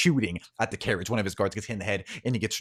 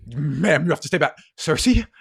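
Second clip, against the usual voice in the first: higher, louder, commanding then surprised; stern guard; 8 semitones higher, 7 dB louder than usual. Acted. Guards ordering Cersei back, then recognising her. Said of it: "two guards approach and go".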